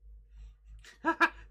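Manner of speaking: very high, airy snickering laugh; squeaky rat cackle. Acted.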